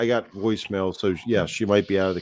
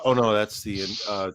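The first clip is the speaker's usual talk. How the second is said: usual speaking voice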